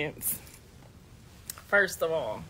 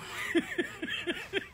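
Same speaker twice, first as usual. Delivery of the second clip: high, softer run of laughter; real cackling; 10 semitones higher, 8 dB quieter than usual. Real, not acted. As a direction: cackle breathlessly at your own story. Cracking up at own story.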